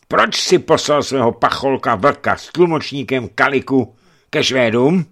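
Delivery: loud, high, pressing question; stern bishop demanding answers; accusing interrogation. A narrator's reading.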